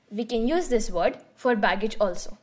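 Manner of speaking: normal talking voice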